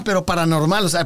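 usual speaking voice

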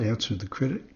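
plain reading voice

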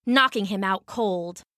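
usual speaking voice

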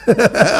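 a brief real chuckle; short laugh. Real, not acted. Rabbi laughing at his joke with students, short laugh. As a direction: laugh at your own joke.

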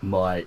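"mate" said as "mite"; imitated wrong "mate". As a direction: mimic a learner turning "mate" into "mite". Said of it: "But you said, like".

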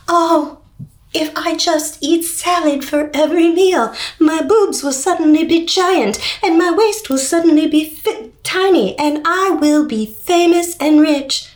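high, airheaded mock voice; mocking a naive influencer fan. Viewer imitating a naive fan of a YouTube influencer, high and airheaded. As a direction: ham up a dreamy girl who believes the salad diet. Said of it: "eat salad for every meal and go".

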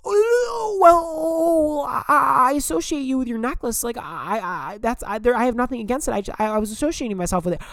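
very high squeaky flustered voice; mocking Jerry. Imitating Jerry flustered after she snaps at him. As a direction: ham up a caught-out nervous guy backpedalling. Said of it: "And he goes".

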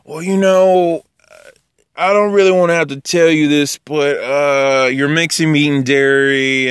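high, put-on voice of a phony do-gooder; fake reluctance. Busybody scolding someone over meat and dairy, mock-reluctant. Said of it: "It's not like a fake front".

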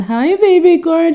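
high, gushing mum on the phone; Lisa impression. Fan playing the star calling her son, high gushing impression. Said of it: "I wanna get in the car and call my son".

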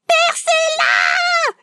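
a long, slow, high scream; drawn-out scream. Retold or imitated. Woman in labour begs the midwife, long slow scream. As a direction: stretch the scream out in agony. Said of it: "je commence à hurler".

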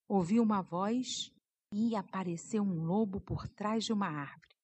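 ordinary delivery

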